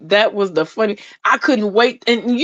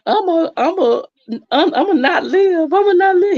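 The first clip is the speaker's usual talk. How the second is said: high, whiny mock sob of a scared man; mock crying whine; 10 semitones higher than usual. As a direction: whimper it like a grown man overreacting. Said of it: "that sucker was crying".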